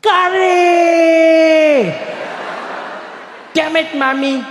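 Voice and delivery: put-on very high, loud child's scream of frustration; little boy yelling at his lunchbox. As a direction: shriek it like a kid sick of curry. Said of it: "he'd scream at the top of his lungs".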